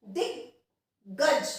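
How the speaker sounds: दिग्गज said very slowly, syllable by syllable, half ग joined to दि; drawn-out teaching demo of दिग्गज. Shown as a demonstration. Teacher slowly models दिग्गज for students to repeat. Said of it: "इसमें ग बहुत छोटा सा आ रहा है".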